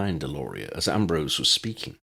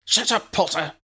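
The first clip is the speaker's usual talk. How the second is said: high, loud snapped order; barked; 14 semitones higher, 4 dB louder than usual. A narrator's reading. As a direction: bark it at him. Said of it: "she barked".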